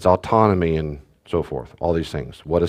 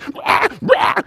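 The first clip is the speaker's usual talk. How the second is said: a short breathy imitated baby shriek; mock baby scream. A dad imitating his screaming baby with a breathy shriek. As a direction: let out a tiny mock scream.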